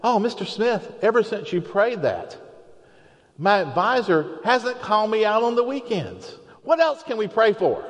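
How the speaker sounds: high, eager student voice; delighted student. Retold or imitated. PhD student thanking Mister Smith, excited and high. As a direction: play the grateful student, all lit up. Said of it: "And he said".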